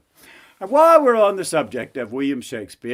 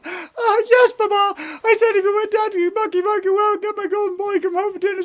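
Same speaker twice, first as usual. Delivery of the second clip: high, wailing spoiled princess rushing her confession; whining princess. Acted. Princess begs papa to release her, whining princess voice. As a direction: whine and plead in falsetto.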